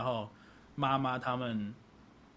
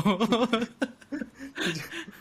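laugh; 8 semitones higher than usual. Real, not acted. 笑着回应买面乳.

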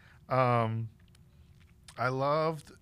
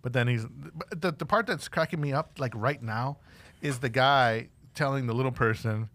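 usual speaking voice

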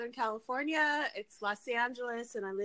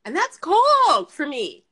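emphatic; 6 semitones higher, 13 dB louder, slower than usual. Real, not acted. Emphatic complaint about cold.

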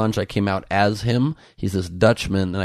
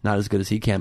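usual speaking voice

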